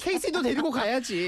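high-pitched mock mom voice, insisting; mom telling him. Mom telling her son to take his little brother along, high mock nag. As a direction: put on a nagging mother ordering him to bring the kid brother. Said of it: "엄마가 친구랑 놀러 가는데".

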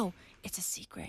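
hushed mock secret-sharing whisper; confiding whisper. Mimes someone confiding a secret.

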